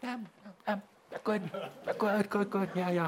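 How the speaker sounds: high, soft hesitant um and good; mock hesitant reply. Teacher mimicking the hesitant answer he will give the Masters, high and soft. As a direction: hem and haw up high, mocking. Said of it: "they're gonna say".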